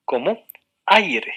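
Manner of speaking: aire slowed down, vowels stretched; slow example word. Shown as a demonstration. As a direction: say aire slowly so the class hears both vowels. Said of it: "puede estar también integrado por dos o tres vocales".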